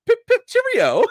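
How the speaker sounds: high, hammed-up British accent; chirpy British cheerio, played for laughs; mock British accent. Vlogger mocks Sonya with a hammed-up British accent.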